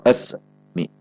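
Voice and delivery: "asmi" cut after a short s, then mi; wrongly paused. Shown as a demonstration. Another warning example, paused asmi. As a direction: split "asmi" with a clear gap.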